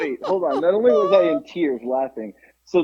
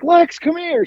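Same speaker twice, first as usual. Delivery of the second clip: high-pitched summons to another room; retold holler, pitched way up; calling out; 14 semitones higher than usual. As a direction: call someone over urgently. Calling Lex from her room.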